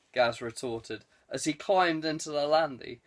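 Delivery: plain reading voice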